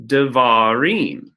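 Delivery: devarim said very slowly, syllable by syllable; careful pronunciation. Shown as a demonstration. Teacher modelling the plural devarim for students. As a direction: draw out devarim so each sound is clear. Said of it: "teacher pronouncing the resulting Hebrew word".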